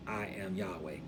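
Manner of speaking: soft, low voice of love; quiet and intimate; tender declaration. Acted. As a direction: say it gently, as to your wife. Said as to a wife, lovingly.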